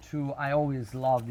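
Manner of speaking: usual speaking voice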